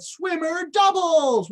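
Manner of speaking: high slow drawn call. Shown as a demonstration. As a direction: call the group name out. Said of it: "so like I said".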